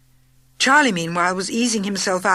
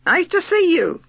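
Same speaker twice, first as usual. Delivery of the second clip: bright, much higher and louder greeting; sweet high greeting; 11 semitones higher, 5 dB louder than usual. A narrator's reading. Bess sweetly greeting Sissy, high and bright. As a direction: coo it up high.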